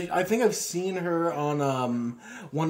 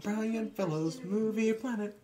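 high, soft character voice; Tracy Morgan; 7 semitones higher, 7 dB quieter, slower than usual. Acted. Comedian's character presenting a show, high mock impression. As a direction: announce it softly in a raised comic voice. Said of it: "if this film starred Tracy Morgan".

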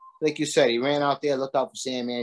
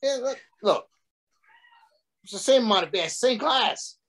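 high, incredulous mock complaint; retold outrage; 11 semitones higher than usual. Telling the waitress about the beer, high incredulous outrage. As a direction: pitch it up in disbelief at the waitress. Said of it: "I said".

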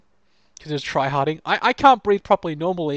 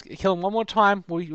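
ordinary talk

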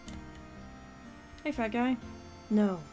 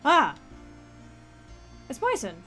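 surprised shout; 5 semitones higher, 8 dB louder than usual. Real, not acted. Excited greeting of arriving character.

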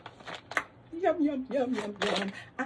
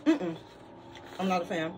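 talking while chewing; muffled through closed lips; mouth full, chewing. Real, not acted. Seen 0: lips pursed, jaw working after eating; hand in chickpea bag.

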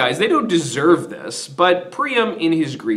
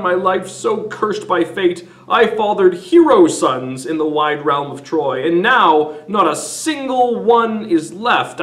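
higher, louder ranting at sons; furious Priam shouting down his sons; raging king; 5 semitones higher, 4 dB louder than usual. Retold or imitated. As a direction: berate them like a grief-mad father. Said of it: "he starts shouting at them and saying".

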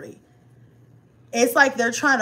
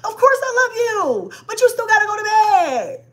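exasperated loving yell; 12 semitones higher, 5 dB louder than usual. Retold or imitated.

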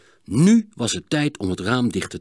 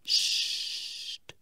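whispered hush; warning shush; 6 dB quieter than usual. A narrator's reading. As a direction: hiss a quick warning shush. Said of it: "waarschuwde zijn broer".

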